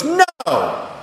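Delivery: sharp, high indignant "No!"; imitating an offended father's retort. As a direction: bristle at the very idea. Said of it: "he was very indignant".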